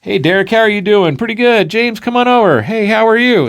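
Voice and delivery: high, friendly mock chatter; cheery greetings. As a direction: act out chirpy pals greeting each other.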